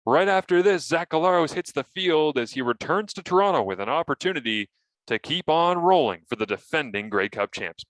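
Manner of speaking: hyped-up TV announcer tease; broadcast tease voice. Retold or imitated. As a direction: sell the upcoming segment like a play-by-play man. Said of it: "and I said".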